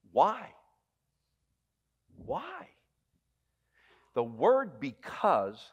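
ordinary delivery

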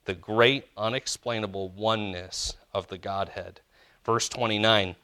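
usual speaking voice

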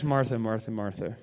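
"Martha, Martha, Martha" low and soft, sinking each time; exasperated triple. Shown as a demonstration. Jesus fed up with Martha, low and weary. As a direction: sigh the name thrice, fed up. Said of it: "If he said it three times".

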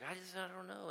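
put-on high, soft little-kid reply; small boy's voice. Five-year-old answering his carer, imitated small voice. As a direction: imitate a sheepish little boy.

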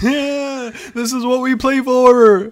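imitated high, loud victory yell; mock triumphant shout from a clip; Charlie. Co-host joining in the clip impression, high rousing yell. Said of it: "that one clip of Charlie going".

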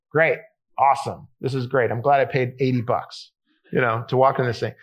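low, deadpan sarcasm; underwhelmed tourist, voice dropped low; dry, half-hearted enthusiasm; mock unimpressed. Unimpressed reaction to small cave.

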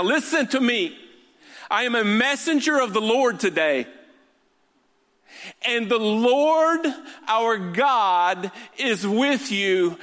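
high, ringing sermon delivery; impassioned preaching. Real, not acted. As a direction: declare it to the congregation as God's messenger. Preaching at full force.

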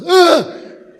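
a sudden high, loud squashed grunt; comic cry of a struck octopus. Acted. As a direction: yelp like something just got whacked. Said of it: "The octopus goes".

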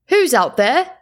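man calling into the dark, rising. A narrator's reading. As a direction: call out across the snow. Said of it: "a man called from the village".

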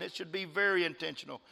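ordinary talk

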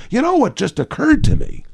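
high-pitched imitation of inspiration; sudden realisation. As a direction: act out someone who has just been struck by an idea. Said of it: "when they have a great idea".

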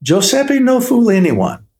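a lightly voiced jolly old baker, welcoming; jolly baker Giuseppe. A narrator's reading. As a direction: greet the boy like a proud, kindly baker. Giuseppe reassures the boy.

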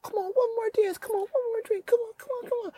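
high, soft wheedling voice; high whiny begging; party friend pleading. Retold or imitated. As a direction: coax them back to the party.

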